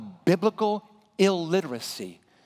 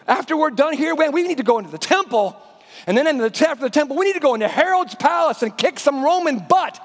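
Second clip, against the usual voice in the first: high, raised, pushy excitement; eager Peter; 5 semitones higher, 7 dB louder than usual. Acted. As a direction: urge Jesus on like a fired-up follower. Preacher voices eager Peter.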